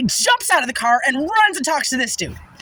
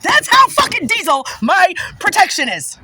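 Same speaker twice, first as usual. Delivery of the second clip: higher and louder, slower proud boast; excited boast; 6 semitones higher, 5 dB louder than usual. Real, not acted. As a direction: crow about it proudly. Boasting about divine protection.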